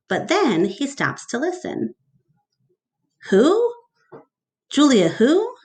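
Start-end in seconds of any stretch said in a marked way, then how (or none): 3.3-5.6 high, loud puzzled asking; puzzled Mac. Acted. Mac on the phone, loud and confused. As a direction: press the caller, confused.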